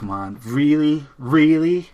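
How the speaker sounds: loud, drawn-out mock incredulity; exasperated disbelief. Acting out disbelief at the song.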